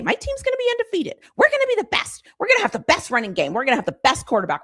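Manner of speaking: hyped fan. Pretend. A preseason fan boasting about their team, squeaky giddy brag. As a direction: brag like a fan sure of an unbeaten season. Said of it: "So you can still say".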